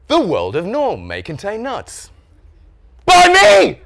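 slow swooping title, then shouted high byline; triumphant title announcement. Shown as a demonstration. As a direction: crow proudly over your own book. Proudly announces his own book.

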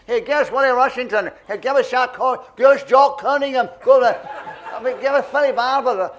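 loud, rowdy calls from the crowd; audience shouting requests. Retold or imitated. Glasgow audience yelling names at the stage, loud shout. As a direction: bellow the names across the hall. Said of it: "they all started shouting out the names".

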